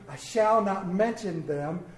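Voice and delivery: normal talking voice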